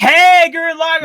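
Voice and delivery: loud, high burst of fan excitement; excited cheer. Real, not acted. Friend spotting an anime poster on the tour, excited cheer. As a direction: cheer loudly at spotting a favorite.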